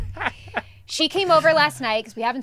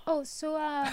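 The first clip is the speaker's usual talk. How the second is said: soft, higher, hesitant mock-casual kid; playing it cool; 4 semitones higher, 8 dB quieter, slower than usual. Mom imitates son fishing about guest's arrival, playing it cool. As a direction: imitate a boy nonchalantly fishing for news, softly. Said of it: "like, playing it cool".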